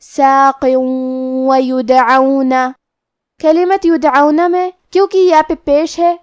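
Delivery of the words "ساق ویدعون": ساق ویدعون, tanween merged into the waw with nasal hold; ساق ویدعون with idgham bighunna; idgham with ghunna. Shown as a demonstration. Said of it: "واو میں ملا کے غنہ اور تشدید".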